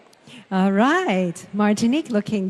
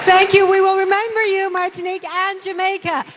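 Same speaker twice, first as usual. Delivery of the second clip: louder and higher, beaming gratitude; enthusiastic host thanks; 6 semitones higher, 3 dB louder than usual. Real, not acted. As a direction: cheer her off the stage.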